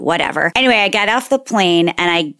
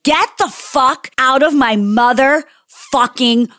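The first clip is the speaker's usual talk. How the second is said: slow, higher-pitched, word-by-word demand; acted-out furious order, drawn out; retold rage; 5 semitones higher, slower than usual. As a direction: order the intruder out, spacing every word. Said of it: "to unleash your rage".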